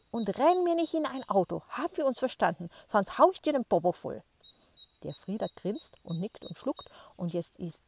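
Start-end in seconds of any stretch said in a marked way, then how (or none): 0.1-4.2 loud, higher shout back; grandma bellowing. Acted. Grandma yells back across the street, loud and higher. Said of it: "brüllte die Oma zurück".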